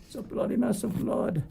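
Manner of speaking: put-on jokey, high English accent. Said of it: "I said that with an English accent".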